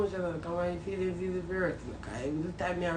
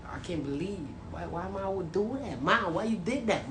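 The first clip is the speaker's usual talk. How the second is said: normal talking voice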